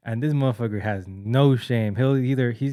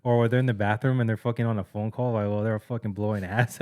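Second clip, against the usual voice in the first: normal talking voice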